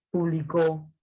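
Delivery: publicó with a drawn-out final O; slow down and lean on the end of publicó; stress on last syllable. Shown as a demonstration. Teacher reading another example, stress on CÓ in publicó. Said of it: "la mayor fuerza de voz".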